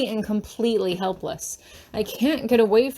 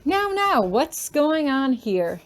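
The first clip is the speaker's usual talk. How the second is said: much higher, slow, commanding; stern professor; 4 semitones higher, slower than usual. Acted. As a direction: break up the scuffle with authority. Professor interrupts the fight.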